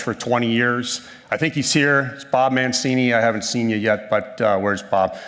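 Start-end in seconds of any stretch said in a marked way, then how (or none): none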